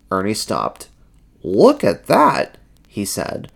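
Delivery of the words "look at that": high, drawn-out character voice; slow, high-pitched wonder; Ernie's voice. A narrator's reading. Ernie speaks in story.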